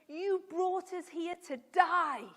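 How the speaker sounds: hammed-up complaint, dragging the last word; acted crossness. Teacher voicing Israelites blaming Moses, loud mock accusation. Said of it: "started getting cross at God".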